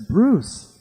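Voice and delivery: high, loud call across the street. Retold or imitated. Stranger on the street calling out to Bruce. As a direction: call his name from down the block.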